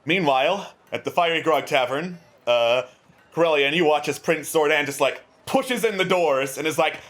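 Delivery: ordinary talk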